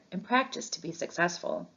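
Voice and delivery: ordinary delivery, nothing marked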